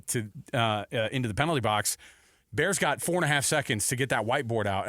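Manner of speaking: usual speaking voice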